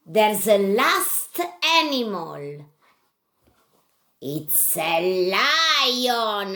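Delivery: normal talking voice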